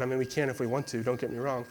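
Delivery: ordinary talk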